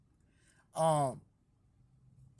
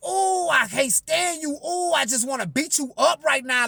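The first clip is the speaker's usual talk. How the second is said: mock lady; 6 semitones higher, 5 dB louder than usual. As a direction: ham up a furious church lady. Voicing the angry lady.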